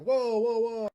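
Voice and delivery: loud, high mock alarm; alarmed onlookers. Coffee shop customers reacting to the threat, alarmed. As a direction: imitate a crowd stepping in loudly. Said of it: "People in the coffee shop were like".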